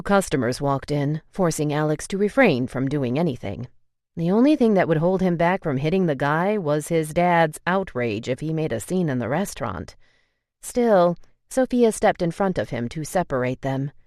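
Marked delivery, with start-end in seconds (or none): none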